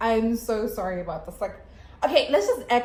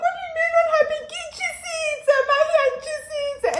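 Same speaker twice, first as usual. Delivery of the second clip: very high, fast wailing, acted out; hammed-up hysterical cry; hysterical retort; 5 dB louder than usual. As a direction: squeal it in re-enacted panic and pain. Panicked retort to helper's question.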